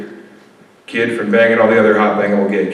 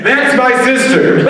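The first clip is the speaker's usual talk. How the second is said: laughing; 10 semitones higher, slower than usual. Pretend. Comedian's punchline answer, laughing.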